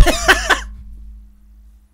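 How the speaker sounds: squealing burst of laughter; high shrieking laugh. Real, not acted. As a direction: cackle at the absurdity. Laugh after outburst.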